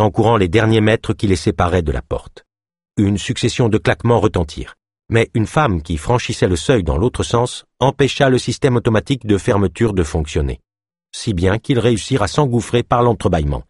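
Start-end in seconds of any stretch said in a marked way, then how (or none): none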